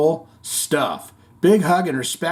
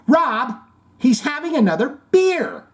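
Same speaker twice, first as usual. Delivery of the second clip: mock tattling; 11 semitones higher than usual. Voicing mock accusation.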